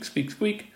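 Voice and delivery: high squeaks; tiny high hamster squeaks. Retold or imitated.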